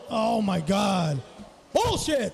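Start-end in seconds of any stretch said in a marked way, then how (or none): 1.7-2.3 high laughing exclamation. Real, not acted. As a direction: laugh the word out. Laughing at an offensive joke.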